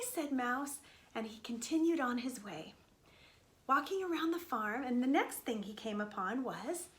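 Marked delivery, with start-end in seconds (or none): none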